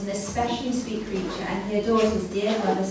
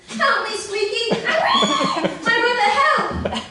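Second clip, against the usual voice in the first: high, loud desperate pig cries, half laughing; pig crying for help; 14 semitones higher, 9 dB louder than usual. A narrator's reading. Trapped pig begging his brother for help, high loud squeals. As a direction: beg your brother to save you, squealing. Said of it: "squeal and cry out to his brother".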